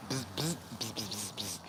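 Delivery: quiet, broken bursts of imitated buzzing; annoyed cicada buzz. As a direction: buzz low and short, a cicada warning someone off. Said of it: "he was making a sound like".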